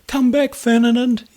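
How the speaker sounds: high, loud call after him; shouting. Acted. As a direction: call out across the river. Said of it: "he shouted".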